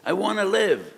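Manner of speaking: loud, high, impassioned plea; crying out. Acted. Person on his deathbed pleads with God, crying out. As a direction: beg at full voice, as if for your life. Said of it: "crying out to Kodesh Baruch Hu".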